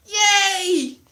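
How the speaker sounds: usual speaking voice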